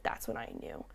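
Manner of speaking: hushed, choked-up whisper imitating a moved man; soft teary-eyed whisper. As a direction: whisper it as if holding back tears. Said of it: "got a little teary-eyed".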